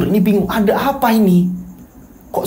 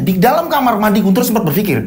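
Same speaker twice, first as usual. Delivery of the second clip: plain reading voice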